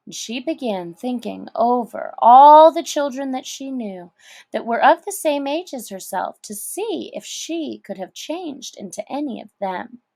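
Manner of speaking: ordinary delivery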